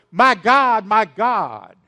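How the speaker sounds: loud anguished cry to God; loud cry of a forsaken man. Acted. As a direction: cry it out to heaven. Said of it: "he slowly began crying out".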